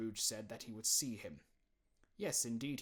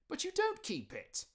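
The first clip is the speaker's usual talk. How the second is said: light, much higher protest; much higher, gently teasing nephew; 6 dB louder than usual. Acted. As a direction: point out the obvious kindly.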